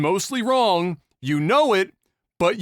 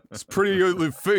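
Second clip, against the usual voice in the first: usual speaking voice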